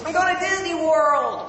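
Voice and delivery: very high, excited American accent. Shown as a demonstration. Brit trying American catchphrase, high American accent. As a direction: cheer it as an exaggerated American. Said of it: "my best American accent".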